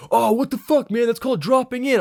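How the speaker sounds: high, loud annoyed surfer; angry surfer voice. Retold or imitated. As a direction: play the offended local surfer.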